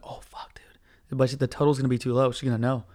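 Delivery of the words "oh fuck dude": unvoiced excited whisper. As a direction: whisper it with glee. Excited secret aside.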